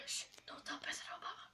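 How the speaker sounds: playful secret whisper. Acted. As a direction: hush it like a secret.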